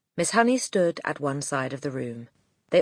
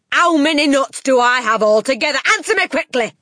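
loud, high-pitched angry tirade; Trunchbull; 8 semitones higher, 8 dB louder than usual. A narrator's reading. Headmistress berating a boy over multiplication, furious shouting. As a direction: hammer every word at full volume. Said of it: "shouted the Trunchbull".

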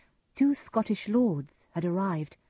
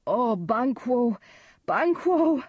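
slow, loud wail of a name, climbing higher; anguished cry; 6 semitones higher, 5 dB louder, slower than usual. A narrator's reading. Macduff calls to Banquo after finding the body, anguished cry. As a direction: cry out to a friend in shock. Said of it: "cried Macduff".

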